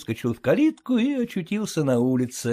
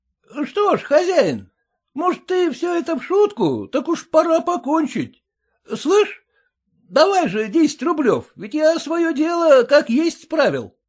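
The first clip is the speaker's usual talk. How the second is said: raised, half-joking coaxing; wheedling farmhand; 12 semitones higher, 4 dB louder than usual. A narrator's reading. Sergei asks the master for his wages, coaxing. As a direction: coax the master to settle up.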